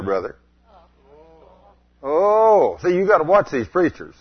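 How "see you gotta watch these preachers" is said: ordinary talk, nothing marked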